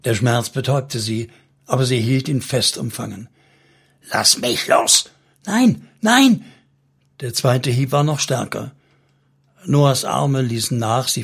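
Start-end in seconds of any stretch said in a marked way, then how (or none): 4.1-5.1 slow, rough demand to be released; angry demand. A narrator's reading. Salmon strikes Noah to get free, rough angry demand. Man shouts while striking her. 5.5-6.4 high, loud cry of refusal; desperate cry. A narrator's reading. Noah clings on despite his blows, desperate high cry. Struck woman refuses to let go.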